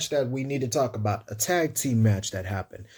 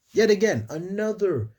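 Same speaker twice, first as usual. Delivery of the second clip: excited; 9 semitones higher than usual. Real, not acted. Excited start of the next segment.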